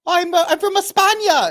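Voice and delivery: high, loud hammed-up Spanish accent; exaggerated Spanish accent; mock Spanish accent. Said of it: "that accent?".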